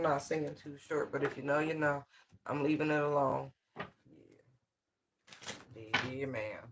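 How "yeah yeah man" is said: ordinary talk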